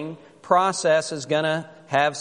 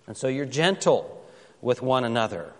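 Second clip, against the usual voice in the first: ordinary delivery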